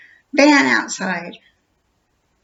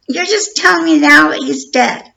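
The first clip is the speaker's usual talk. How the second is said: higher and louder, outraged; outraged outburst; 7 semitones higher, 10 dB louder than usual. Retold or imitated. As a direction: let the anger burst out on telling. Retold angry call with detective.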